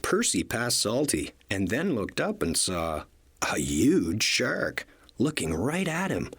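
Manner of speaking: ordinary delivery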